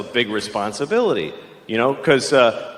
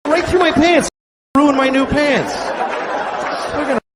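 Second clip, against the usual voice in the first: mock outrage; 11 semitones higher than usual. Acted outrage at the ATM.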